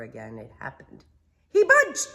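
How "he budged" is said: excited shout. Acted. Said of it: "Rabbit said".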